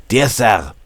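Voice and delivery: put-on German accent. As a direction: open the letter in a German accent. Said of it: "I have to read this with a German accent".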